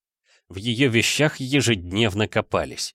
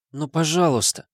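high pleading plea; 6 semitones higher than usual. A narrator's reading. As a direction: beg sweetly, higher. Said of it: "умоляюще заглянула". (умоляющая просьба)

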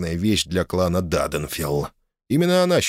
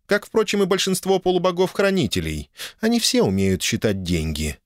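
lightly voiced dragoness; 7 semitones higher than usual.

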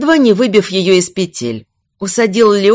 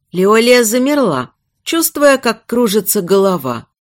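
plain reading voice

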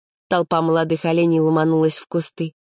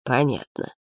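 softened, dismissive grunt of a reply; snorting; 3 semitones lower, 4 dB quieter than usual. A narrator's reading. Lad dismisses the girl, snorting. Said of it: "хмыкнул парень".

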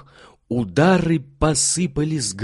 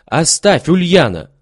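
loud, higher commanding call; shouting; 5 semitones higher, 6 dB louder than usual. A narrator's reading. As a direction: stop the servant with a shout. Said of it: "крикнула Марфа Ивановна".

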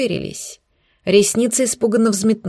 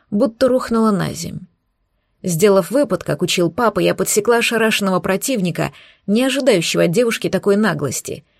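ordinary delivery, nothing marked

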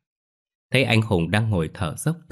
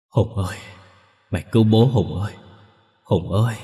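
wailing ghost father; 4 dB louder than usual. A narrator's reading. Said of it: "tiếng khóc than rên rỉ của lão Tịnh".

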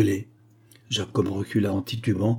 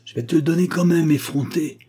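high, loud irritated insistence; cross old man; grumbling anger; 7 semitones higher, 5 dB louder than usual. A narrator's reading. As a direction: grouse at them loudly. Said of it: "grognait Jacob".

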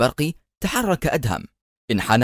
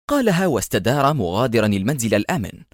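usual speaking voice

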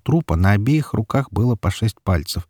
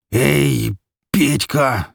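pained growl; 5 semitones higher, much slower than usual. A narrator's reading. Wounded Mikola calling his son, growling through pain. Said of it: "прорычал Микола".

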